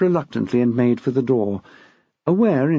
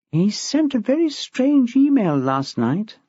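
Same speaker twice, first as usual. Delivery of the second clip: a touch higher, a hint of a worried young teacher; lightly voiced young woman; 9 semitones higher, slower than usual. A narrator's reading. Anxious teacher reporting to the head, lightly voiced. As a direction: voice Nicole a little higher than the narration. Nicole's voice in the story.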